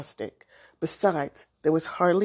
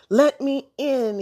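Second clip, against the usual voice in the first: high, drawn-out plea; complaining cat's slow, high call; crying out; 7 semitones higher, 4 dB louder, slower than usual. A narrator's reading. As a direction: cry to be let in, stretching it. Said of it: "he cried".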